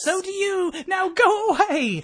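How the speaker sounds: laughing, slow get-out-of-here; laughing dismissal. Acted. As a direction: brush her off while laughing.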